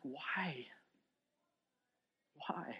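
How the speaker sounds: whispered, unvoiced question; grief-stricken whisper; whispered why. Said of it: "And we ask".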